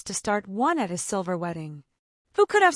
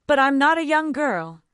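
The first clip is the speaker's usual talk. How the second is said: higher, louder retort, unbowed; defiant retort; 8 semitones higher, 8 dB louder than usual. A narrator's reading. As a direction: fire it straight back at her. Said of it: "retorted Valancy uncrushed".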